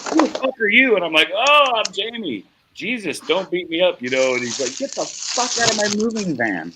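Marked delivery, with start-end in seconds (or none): 0.1-0.9 high-pitched retold challenge to a stranger; startled anger. Van owner confronts stowaway. 1.3-4.0 retold panicky plea, higher; mock-frightened, begging for mercy; alarmed pleading. Stowaway pleads not to be beaten. 4.8-6.7 high-pitched retold eviction; angry order. Van owner orders him out.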